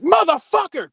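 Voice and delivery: imitating a cop flipping out, high and loud; retold enraged curse, voice leaping up; irate outburst. Cop flips out on seeing the BB gun, irate. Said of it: "flips the fuck out. He is irate".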